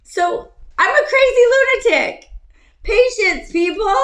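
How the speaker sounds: high, loud self-mocking outburst; worked-up shout. Real, not acted. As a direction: shout it high, still wound up. Still riled up, mocking herself.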